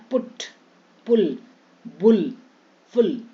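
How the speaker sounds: quick "u" in पुट, पुल, बुल, फुल; short u vowel. Shown as a demonstration. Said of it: "अब ऊ का उच्चारण".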